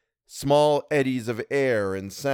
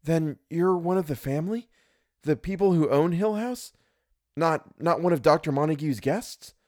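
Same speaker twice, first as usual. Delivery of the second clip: higher, startled questions; 7 semitones higher than usual. A narrator's reading. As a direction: blurt it out in surprise. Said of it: "startled into speaking".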